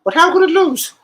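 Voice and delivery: high, loud whiny mock of a sore loser; imitating a friend's high outraged whine. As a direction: squeal it like a wounded punter. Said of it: "He was like".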